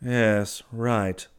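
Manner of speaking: ordinary delivery, nothing marked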